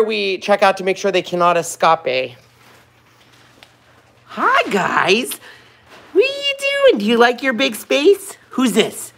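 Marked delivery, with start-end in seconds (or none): 4.3-9.1 much higher, sing-song voice for the pets; pet talk. Real, not acted. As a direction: coo at the little animals. Greeting the pets in their pen.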